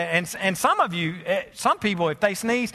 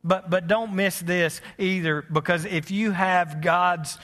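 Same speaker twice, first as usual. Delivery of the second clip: reflective sermon; 3 semitones lower than usual. Acted. Quiet reflective sermon close.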